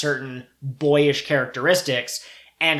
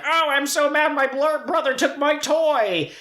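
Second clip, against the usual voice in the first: whiny monster voice; 11 semitones higher than usual. Retold or imitated. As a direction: pout it like a sulky monster. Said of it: "the monster says".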